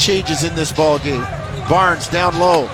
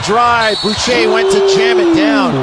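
rising to a big shouted call; excited call; 8 semitones higher, 6 dB louder than usual. Real, not acted. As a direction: build the call and shout the dunk. Announcer calls a fast break dunk.

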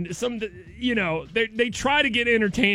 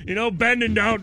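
ordinary talk, nothing marked

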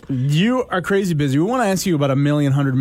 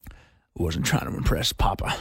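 whispered movie quote; 3 semitones lower, 4 dB quieter than usual. Retold or imitated. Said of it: "Bradley Cooper goes".

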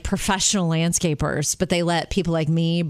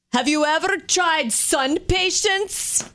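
put-on Jamaican accent; 7 semitones higher, slower than usual. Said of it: "he had the most awesome Jamaican accent, which I can't do service to".

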